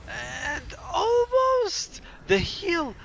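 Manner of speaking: high, stretched-out cry of excitement; excited yell. Real, not acted. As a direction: scream it as the play nearly lands. Caster yells at close fight.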